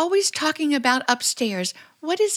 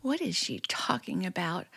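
whisper; 6 semitones lower, 11 dB quieter than usual. Acted. Alone, frightened, whispering to herself.

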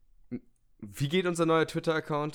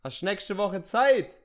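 calling out; 7 semitones higher, slower than usual. Pretend. Calling to neighbour.

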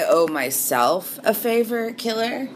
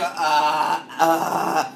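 imitating; 3 semitones lower, much slower than usual. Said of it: "Not even the right pitch".

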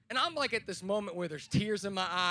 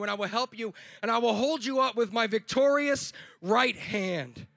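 louder, rising sermon voice; preaching; 3 semitones higher, 6 dB louder than usual. Acted. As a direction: preach it with growing fire. Preaching builds up.

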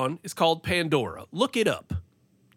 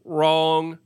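loud; 5 dB louder than usual. Real, not acted. Sudden loud rebuttal.

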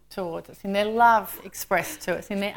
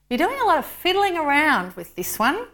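higher-pitched querying voice; questioning angel; 8 semitones higher, 3 dB louder than usual. A narrator's reading. Angel querying the Lord's work, questioning.

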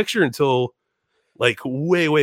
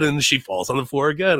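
ordinary talk, nothing marked

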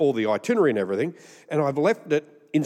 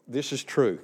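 ordinary talk, nothing marked

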